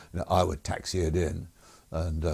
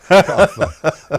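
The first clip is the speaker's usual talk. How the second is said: loud high laugh; real loud laughter; burst of laughter; 11 semitones higher, 15 dB louder than usual. Real, not acted. Laughing at the memory.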